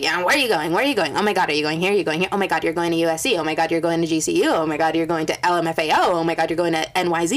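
rapid-fire mock excited chatter; breathless run-on imitation of classmates; mimicking nosy classmates. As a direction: rattle it off fast, like everybody gushing at once. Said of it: "Everybody is like".

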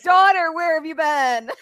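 put-on loud, high theatrical hail to a long-lost child; mock calling out. Cosplayer greeting a stranger as her daughter, loud mock call.

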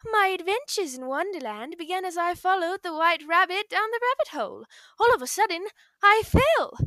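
put-on high, bright storybook girl; Alice voice. Shown as a demonstration. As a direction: tell it like a wide-eyed young heroine. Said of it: "a little segment that I also have in my drafts".

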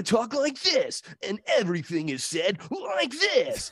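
high, mock hard-sell delivery; boys' toy-commercial announcer. Mocking marketing to young boys, hyped announcer voice. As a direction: parody a kids' ad announcer. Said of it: "same exact, like, tempo and timbre".

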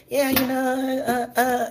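high, hesitant mock voice; mock Kourtney. Kourtney at dinner, high and hesitant. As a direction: imitate an awkward mom. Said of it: "Kourtney's like".

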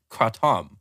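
Kratom as "kra-TOM"; kra-TOM for Kratom, full second syllable; Thai pronunciation. Shown as a demonstration. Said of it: "the way that the Thai people say it".

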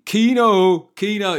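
loud, high shout of a nickname; mock rowdy stag-do yell; calling out. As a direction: bellow his name across the room. Calling out to a friend.

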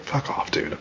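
soft, breathy under-the-breath fuck off; quiet dismissive aside; muttered brush-off. Real, not acted. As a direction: mutter it half to yourself. Muttered dismissal of whiny fans.

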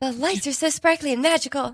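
high, dreamy put-on voice; woozy wonderment. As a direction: act dazzled, as if on cough medicine. Acts out cough-medicine haze.

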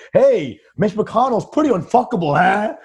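brash mock roast delivery; calling out. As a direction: call it out across a banquet room. Acting out a heckle at the dinner.

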